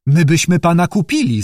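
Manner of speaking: eager exclamation. Acted. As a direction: call it out on impulse. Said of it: "zawołałem".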